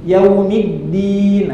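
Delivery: Yaumiddin very slow and careful; model pronunciation. Shown as a demonstration. Teacher modelling Yaumiddin slowly for the class. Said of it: "sebisanya sampai seperti tadi".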